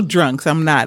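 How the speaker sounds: ordinary talk, nothing marked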